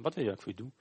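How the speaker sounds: quick, soft, offhand question; simpel, gewoon. Acted. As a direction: ask it casually, as an everyday thing. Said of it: "Gewoon zo simpel". (zachte, terloopse vraag)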